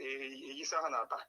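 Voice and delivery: usual speaking voice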